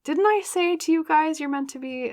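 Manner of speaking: high-pitched, excited vindication; excited I-told-you-so. Real, not acted. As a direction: squeal it as if proven right. Vindicated by the card drawn.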